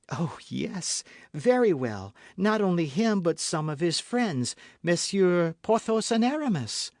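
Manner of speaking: lightly voiced young woman; young novice voice. A narrator's reading. Novice voiced in read story.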